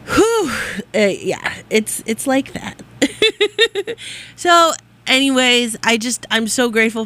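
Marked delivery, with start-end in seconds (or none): 2.9-4.2 laugh. Real, not acted. Laughs after trailing off.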